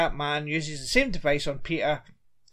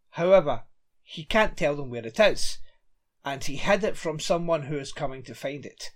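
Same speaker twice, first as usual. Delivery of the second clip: ordinary talk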